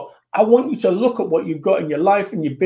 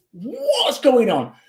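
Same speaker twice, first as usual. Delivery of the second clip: long drawn-out, high-pitched disbelief; mock amazement; 5 semitones higher, much slower than usual. Speaker boggling at his record profitability, mock amazement. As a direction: stretch it out slowly in comic astonishment.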